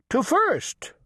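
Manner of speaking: ordinary delivery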